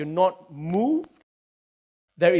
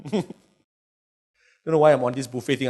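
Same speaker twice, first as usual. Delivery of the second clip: ordinary talk, nothing marked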